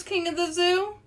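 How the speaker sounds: ordinary delivery, nothing marked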